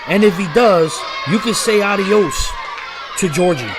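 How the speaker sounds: live play-by-play call; wrestling commentator. Real, not acted. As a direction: call the match as it happens.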